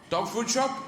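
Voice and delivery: high, rising question; eager lift at the end; food-obsessed dog. Acted. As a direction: ask hopefully as a dog. Guide dog asking about food.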